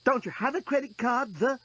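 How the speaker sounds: put-on young woman voice. Lucy asks, higher voice.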